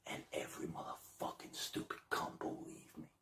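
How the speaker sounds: whispered, secretive storytelling; conspiratorial whisper. Real, not acted. Held whisper narration.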